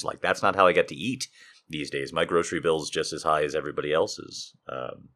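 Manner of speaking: normal talking voice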